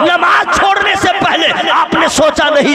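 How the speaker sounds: loud, driving Urdu sermon; fiery preaching. Acted. Preacher rousing the congregation, loud and fervent. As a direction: preach it hard to the crowd.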